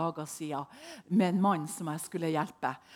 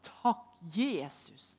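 usual speaking voice

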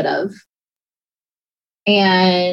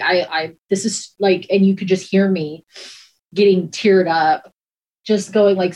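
usual speaking voice

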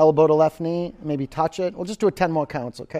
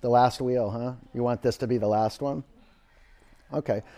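ordinary talk, nothing marked

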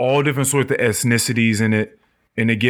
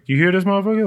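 higher, incredulous scoff; mocking disbelief; 9 semitones higher, much faster than usual. Dream to Death about the drunk, incredulous scoff. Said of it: "looking at each other like".

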